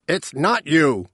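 an understated, slow shout. A narrator's reading. Father insisting mother is blameless, restrained shout. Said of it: "the father shouted".

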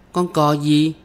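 put-on high child's question; small girl wanting to know; curious little girl. A narrator's reading. Child asks which stork rhyme.

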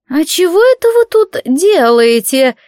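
put-on high, slow, sly old woman voice; old hag. A narrator's reading. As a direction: creep in as a wheedling old crone. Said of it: "раздался вкрадчивый старушечий голос".

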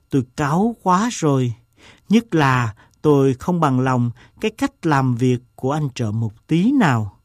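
plain reading voice

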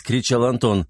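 ordinary delivery

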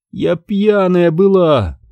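lightly acted shaky mumble; trembling mumble. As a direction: confess it haltingly, slowly. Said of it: "забормотала она дрожащим голосом".

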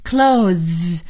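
"clothes" slowly, clo plus a long buzzed ending; step-by-step pronunciation. Shown as a demonstration. Teacher joining the sounds for students, "clothes" drawn out. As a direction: stretch "clothes" into its final voiced friction. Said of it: "先发clo".

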